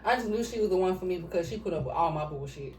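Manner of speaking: ordinary talk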